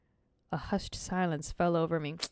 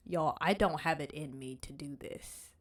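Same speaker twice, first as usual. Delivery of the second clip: normal talking voice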